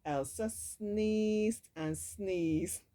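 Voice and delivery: ordinary delivery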